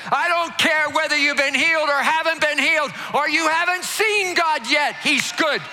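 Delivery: shouted high preaching, driving the crowd; preaching at full force. Acted. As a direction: preach it out loud to the whole room.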